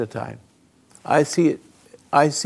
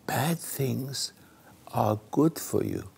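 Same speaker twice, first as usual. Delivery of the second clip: quiet statement; 8 dB quieter, slower than usual. Shown as a demonstration. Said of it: "In other words".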